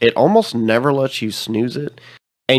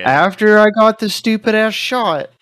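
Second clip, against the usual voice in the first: exasperated; 10 semitones higher, 5 dB louder than usual. Real, not acted. Complaining about the shot.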